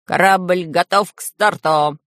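slow, measured, each word stamped out; crisp announcement. Acted. Engineer reports the ship is ready, crisp and measured. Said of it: "отчеканил Самоделкин".

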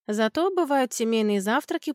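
ordinary delivery

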